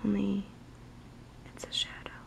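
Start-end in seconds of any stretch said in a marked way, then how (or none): none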